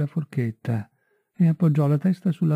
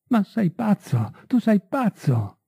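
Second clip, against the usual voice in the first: higher, slow, a mild cry of disbelief; 7 semitones higher, slower than usual. A narrator's reading. Marie refusing Felix's news, a slow higher cry. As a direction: protest in shocked disbelief. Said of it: "Lei gridò".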